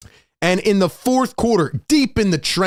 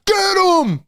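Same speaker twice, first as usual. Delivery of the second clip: screaming get him; 11 semitones higher, slower than usual. Retold or imitated. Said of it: "And I'm screaming".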